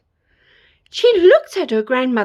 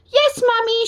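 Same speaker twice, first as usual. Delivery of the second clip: put-on little girl voice; 9 semitones higher than usual. Girl answers her mother.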